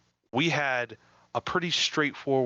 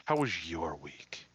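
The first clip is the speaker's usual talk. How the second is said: whisper; 4 semitones lower, 5 dB quieter, slower than usual. Pretend. Playfully asks co-host about week.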